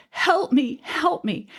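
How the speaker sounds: imitating her own terrified scream on the train floor; retold screaming. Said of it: "just screaming".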